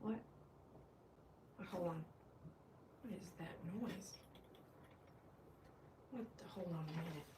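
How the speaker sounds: hushed alarm. Real, not acted. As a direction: go very soft, startled by a noise. Hears noise, hushed.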